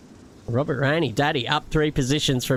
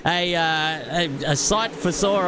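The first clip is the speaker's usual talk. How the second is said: race commentary; 5 semitones higher than usual. Acted.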